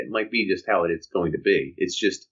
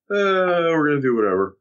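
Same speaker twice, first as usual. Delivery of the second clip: long high "uh" sliding down into resignation; deflated mock podcaster; 5 semitones higher, 4 dB louder than usual. Co-host mimics a podcaster losing hope, deflated and indifferent. Imitating a podcaster who lost confidence.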